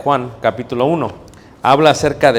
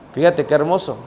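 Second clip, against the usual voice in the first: normal talking voice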